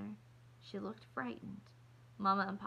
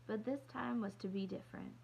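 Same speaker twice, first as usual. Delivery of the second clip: plain reading voice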